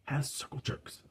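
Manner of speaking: whispered scandalous detail; hushed scandal. Retold or imitated. Recap host passing on Lisa's gossip to listeners, in a whisper. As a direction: drop to a whisper for the juicy gossip.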